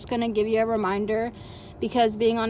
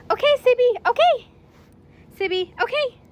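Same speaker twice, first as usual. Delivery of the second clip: much higher and louder cheerful okay; excited release; 12 semitones higher, 9 dB louder than usual. Real, not acted. Trainer releasing the puppy with her free word, high and excited.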